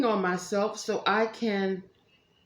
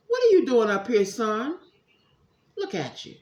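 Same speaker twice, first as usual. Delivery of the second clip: ordinary delivery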